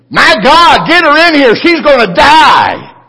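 frantic high shout, stretched slow at the end; panicked scream. Retold or imitated. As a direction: scream it like a terrified nurse. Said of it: "She screamed".

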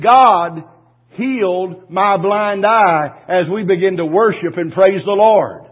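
loud, slow, ringing praise; joyful testimony. Retold or imitated. Healed woman to her pastor, loud joyful testimony. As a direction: proclaim the healing with full voice.

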